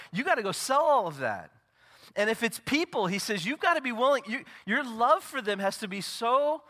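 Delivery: high, loud, ringing pulpit delivery; preaching at full force. Acted. Pastor to congregation, preaching at full force. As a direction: proclaim every promise to the back row.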